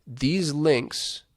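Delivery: ordinary talk, nothing marked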